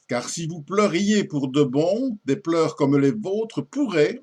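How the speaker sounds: lady's rebuff, launched high and emphatic; poem recitation. Acted. Lady sending the suitor away, theatrical poem recitation. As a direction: declaim the verse as a lady dismissing her suitor.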